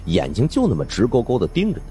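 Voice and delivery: plain reading voice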